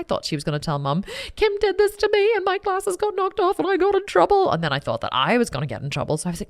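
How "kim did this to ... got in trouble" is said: high, whining tattle; whiny tattletale sister. Retold or imitated. Sister telling Mum on Kim, high and whiny.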